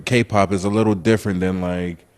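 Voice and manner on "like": ordinary talk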